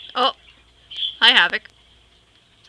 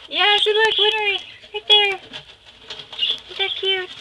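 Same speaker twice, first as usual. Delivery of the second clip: high, excited cooing; cooing pet voice; 10 semitones higher than usual. Real, not acted. Showing the viewer where Winry is, high and excited. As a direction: point out the bird in a sing-song voice.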